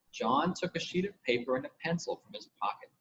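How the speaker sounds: ordinary delivery, nothing marked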